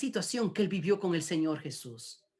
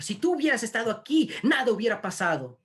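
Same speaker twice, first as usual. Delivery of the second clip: high, raised-voice complaint; mock reproach; 6 semitones higher, 4 dB louder, faster than usual. Sisters resenting the Lord's absence, mock reproach. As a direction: blame him in an acted outburst. Martha and Mary's reproach acted.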